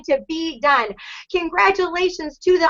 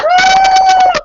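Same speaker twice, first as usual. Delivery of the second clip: high loud whoop of joy; cheer; 8 dB louder than usual. Real, not acted. Team leader cheering a new director, loud whoop.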